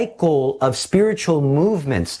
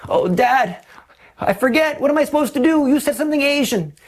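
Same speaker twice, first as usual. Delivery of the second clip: put-on much higher, panicky voice of a lost boy; confused son; 11 semitones higher, faster than usual. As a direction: play the boy stuck in the store, high and pleading.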